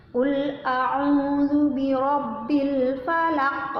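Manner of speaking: slow, drawn-out tajwid chant; Quran recitation. Shown as a demonstration. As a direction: stretch each syllable in recitation. Teacher recites verse one.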